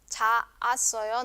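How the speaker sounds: cha asoyo slowly, two separate a sounds; wrongly uncontracted. Shown as a demonstration. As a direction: hold "cha-a" apart in cha asoyo. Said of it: "no, sino que se forma también esta una sola sílaba".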